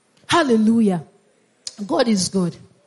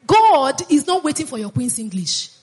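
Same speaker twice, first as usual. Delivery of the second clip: usual speaking voice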